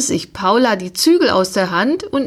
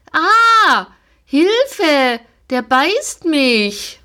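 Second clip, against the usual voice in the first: high startled cry of help, slowing at the end; frightened cry; 8 semitones higher, much slower than usual. A narrator's reading. Girl startled when the pony snaps at her leg, crying out. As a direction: yelp as if bitten.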